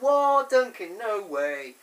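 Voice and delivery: loud, high shocked outburst, falling away; mock disbelief. Viewer hearing Duncan's name, loud mock disbelief. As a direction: blurt it out in staged shock.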